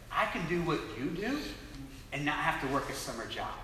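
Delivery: low, hushed, tentative ask; nervous request. Retold or imitated. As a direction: trail down into a timid, soft plea. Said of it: "and I'm nervous".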